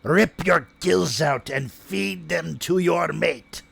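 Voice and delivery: slow, menacing mermaid beast; drawn-out threatening creature growl; sea monster voice. Acted. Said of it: "the gurgles of the creature turn into".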